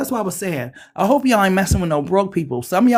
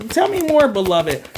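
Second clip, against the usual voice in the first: excited catchphrase; 5 semitones higher than usual. Real, not acted. Tarot reader's catchphrase to the cards.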